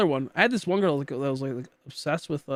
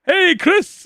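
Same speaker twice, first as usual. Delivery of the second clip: high, loud imitation of him hailing someone; sudden call-out; 14 semitones higher, 8 dB louder than usual. Streamer imitating a man suddenly switching to English, high loud call. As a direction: call out a mock excited greeting, high and loud. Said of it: "all of a sudden he'll go".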